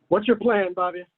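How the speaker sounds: ordinary delivery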